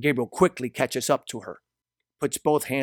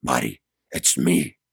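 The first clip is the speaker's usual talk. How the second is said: low, louder, urgent plea; anxious reply; 10 semitones lower, 5 dB louder than usual. A narrator's reading. Gabriel tells frightened Mari who he is, anxious deep voice. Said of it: "Gabriel anxiously responds".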